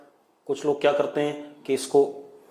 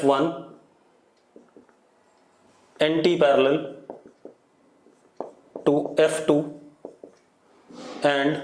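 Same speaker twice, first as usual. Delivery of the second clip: word-by-word dictation with long pauses; slow dictation; much slower than usual. Real, not acted. Teacher dictating a dipole statement to students, slow dictation. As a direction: dictate slowly, leaving gaps to write.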